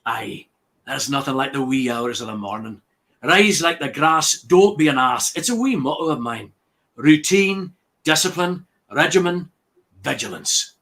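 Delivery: loud, self-important Scottish Highland cow; Angus, Scottish accent. Acted. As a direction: proclaim each motto like a pompous Scot, full of himself. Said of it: "you didn't get to do the Scottish accent".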